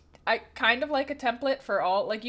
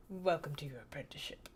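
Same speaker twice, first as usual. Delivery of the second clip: a breathy, secretive hiss of a welcome; mock-sinister whisper; 9 semitones lower, 11 dB quieter than usual. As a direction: whisper it like a cult initiation. Mock-ominous greeting to new follower.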